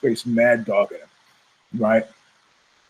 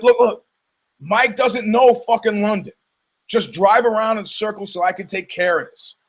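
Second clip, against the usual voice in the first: higher-pitched mock urgency; acted urgent plea; 7 semitones higher than usual. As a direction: plead with the driver, putting it on.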